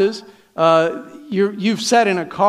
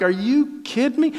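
higher-pitched disbelief, acted out; mock upset; 5 semitones higher, 3 dB quieter than usual. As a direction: play the incredulous driver. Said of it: "I'm so upset".

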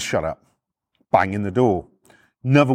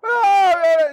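high-pitched mock yell; imitated screaming; 4 dB louder than usual. Copper heard screaming inside the house, mock yell. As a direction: wail like muffled screaming from a house. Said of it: "just shouting in the house".

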